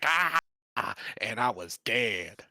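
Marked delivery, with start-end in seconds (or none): 0.0-0.4 short high yelp of pain, louder. Retold or imitated. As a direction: cry out as the shockwave hits. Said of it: "and then I was all like".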